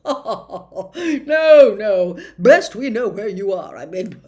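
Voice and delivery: laughing. A narrator's reading. Minister covering up with loud laughter. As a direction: laugh through the words. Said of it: "hearty laugh".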